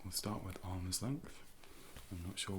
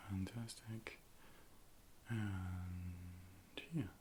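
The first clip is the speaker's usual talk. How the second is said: usual speaking voice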